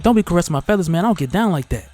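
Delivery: retold indignant telling-off; indignant warning. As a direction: tell off someone pawing at you, playfully. Retold warning to man hitting wing.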